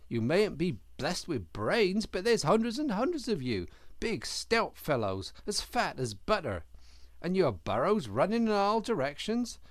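reproachful, building indignation; scolding. A narrator's reading. Otter scolds the rabbits.

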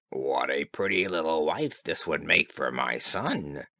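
put-on low croaky old toad; deep scheming toad mother.

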